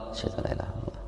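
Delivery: the line again in a soft whisper; whispered repeat. Shown as a demonstration. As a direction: hush it down to a whisper. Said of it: "هذا ترجيع". (همس خافت بالشهادة)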